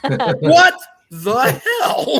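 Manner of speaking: high, drawn-out, laughing disbelief; incredulous outburst. Acted. Viewer reacting to one surprise member of the Ravagers, incredulous. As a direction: stretch it out high and loud through laughter.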